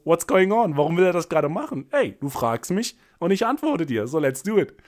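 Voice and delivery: hammed-up disbelief turning into a challenge; mock bewildered. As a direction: play her baffled, then daring. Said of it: "so nach dem Motto".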